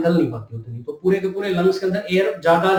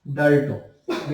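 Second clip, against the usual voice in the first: slow repeated term; 4 semitones lower, 4 dB quieter, much slower than usual. Shown as a demonstration. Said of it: "repeats the term slowly".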